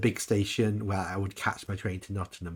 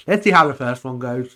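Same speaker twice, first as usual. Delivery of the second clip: louder quote; 3 semitones higher, 9 dB louder than usual. Retold or imitated. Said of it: "people just".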